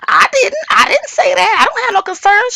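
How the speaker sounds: high-pitched imitated protest; hammed-up high, hurried-to-object voice; speaking up. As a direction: blurt a squeaky mock denial. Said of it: "speak up and say".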